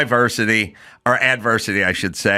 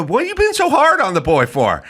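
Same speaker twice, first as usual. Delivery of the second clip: higher, whiny imitated parent voice; mock protective parent; 10 semitones higher, faster than usual. Parent defending a tired son to the coach, mock whiny plea. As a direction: plead for the boy like a coddling parent.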